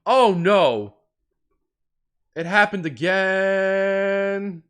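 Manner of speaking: high, drawn-out lament; mock dismay. Player lamenting it happened again, slow mock-dramatic wail. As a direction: stretch each phrase in hammed-up despair.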